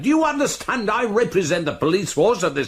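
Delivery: outraged, rapid-fire dressing-down; indignant tirade. Acted. Chief constable berating a defiant boy, indignant. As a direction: pull rank in a rising temper.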